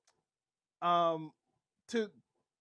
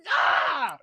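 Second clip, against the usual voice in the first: loud delighted shriek; excited scream; 6 semitones higher, 8 dB louder than usual. Real, not acted. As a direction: scream with glee. Thrilled scream.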